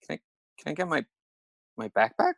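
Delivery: timid stop-and-start mumbled plea; sheepish, timid. Retold or imitated. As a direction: imitate a hesitant, halting request. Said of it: "he's kinda sheepish".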